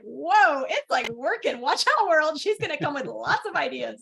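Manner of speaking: excited brain. Retold or imitated. Speaker voices her brain waking after surgery, excited and loud. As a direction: play an excited brain cheering itself on. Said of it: "My brain was like".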